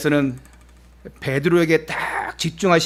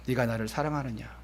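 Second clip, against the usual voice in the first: much softer, lower, tender asking; gentle question; 5 semitones lower, 12 dB quieter than usual. Acted. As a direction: ask it softly instead of scolding. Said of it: "질책하시고 따지시는 것이 아니라". (질책 없이 아주 부드럽고 낮게 묻기)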